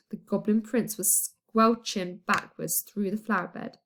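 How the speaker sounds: ordinary delivery, nothing marked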